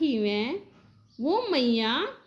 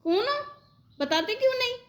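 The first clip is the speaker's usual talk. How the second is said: put-on high, urgent call after fleeing boys; calling out at thieves; 11 semitones higher, faster than usual. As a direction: shout after them, demanding who they are. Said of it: "मैं कह रही".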